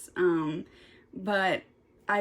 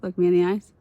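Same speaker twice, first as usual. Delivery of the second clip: louder insistent demand; 3 semitones lower, 8 dB louder, faster than usual. Real, not acted. Telling her viewers to look at her, louder and firmer. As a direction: repeat it harder and louder, dead serious. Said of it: "I want you all to know that".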